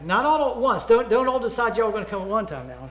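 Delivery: mock-scold the whole crowd; joking caution, voice jumping up; playful warning. Real, not acted.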